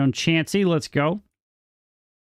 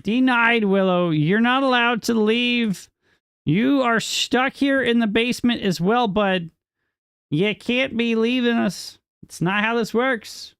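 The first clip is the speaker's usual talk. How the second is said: mock stern denial; 10 semitones higher than usual. Mock-stern teasing a departing viewer.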